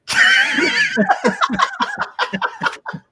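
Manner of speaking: very high-pitched, real laughter; real laughing fit. Real, not acted. Host amused while wrapping up, long high laugh. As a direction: crack up in a long, high giggle.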